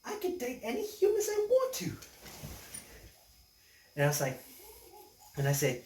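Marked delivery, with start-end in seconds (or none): 0.1-1.9 very high falsetto voice; female kangaroo. Acted. Lovestruck kangaroo insisting she can date humans, falsetto. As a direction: argue back in a squeaky falsetto. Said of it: "she said".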